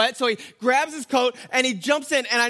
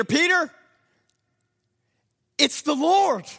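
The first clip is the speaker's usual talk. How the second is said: higher and louder, thrilled; excited; 5 semitones higher, 4 dB louder, slower than usual. Acted. Disciple telling Peter the Lord is here, excited. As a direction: burst out with joy at the news. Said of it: "That's an exclamation mark, so I know he's excited".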